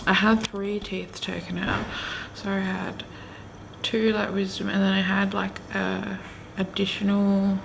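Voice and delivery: talking with gauze in mouth; mumbling through a numb, sore mouth; with a sore jaw. Real, not acted. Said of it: "I realise I sound really, really ridiculous right now".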